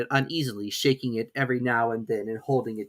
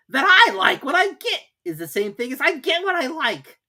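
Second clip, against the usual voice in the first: much higher, louder Hare voice; March Hare; 13 semitones higher, 7 dB louder than usual. A narrator's reading. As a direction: join the teasing.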